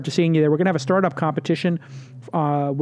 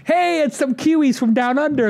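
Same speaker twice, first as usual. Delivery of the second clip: much higher, louder cheeky tourist; mock tourist; 10 semitones higher, 4 dB louder than usual. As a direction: tease Sydney people with a dad joke, pitched way up. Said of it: "It's my standard dad joke".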